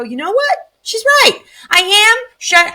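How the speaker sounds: high, emphatic self-admission; acted realization. Mom admitting her daughter is right, emphatic. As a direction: pitch it way up, conceding the point to yourself.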